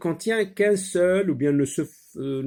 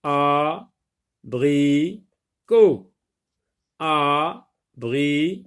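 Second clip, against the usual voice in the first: "abricot" slowly as a, bri, cot, long gaps between; three spaced, held beats on "abricot"; syllable by syllable; 4 dB louder, slower than usual. Shown as a demonstration. Teacher doing the syllable-tapping exercise, "abricot" in three separate beats. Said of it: "frappe les syllabes et lis les mots".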